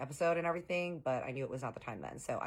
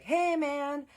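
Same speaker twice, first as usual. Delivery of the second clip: high, loud hey man called across the room; called greeting; 13 semitones higher, 7 dB louder than usual. Retold or imitated. Stranger calls to Harley across the waiting room, high and loud. Friends calling out at the airport.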